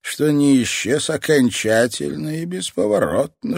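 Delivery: put-on a menacing old man savouring every word; creepy old man voice. Old toy master speaks.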